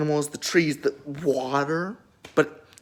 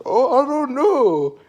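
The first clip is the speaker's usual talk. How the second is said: higher, louder, slow innocent-acting voice for Cain; Cain's voice; 7 semitones higher, 7 dB louder, slower than usual. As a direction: play dumb with a drawn-out innocent shrug. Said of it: "And Cain's like".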